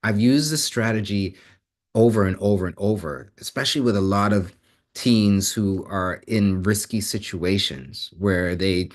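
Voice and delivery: ordinary delivery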